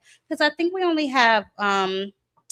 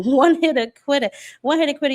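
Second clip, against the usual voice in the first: excited cheer; 5 semitones higher, 5 dB louder, faster than usual. Real, not acted. Cheering a knockout pick.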